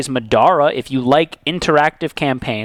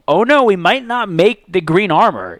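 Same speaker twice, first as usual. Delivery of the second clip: higher, hammed-up alarm; mock panic; 6 semitones higher, 3 dB louder than usual. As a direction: play-act a mounting panic. Acts out season's panicked moments.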